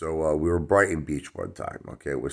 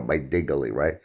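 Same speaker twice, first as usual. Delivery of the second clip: ordinary talk, nothing marked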